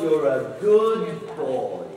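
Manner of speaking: slow, drawn-out praise with a falling tune; nicer praise. Shown as a demonstration. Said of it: "And that one was".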